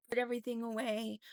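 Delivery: usual speaking voice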